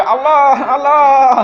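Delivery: high, loud cry of Allah; panicked mock cry. People screaming in earthquake.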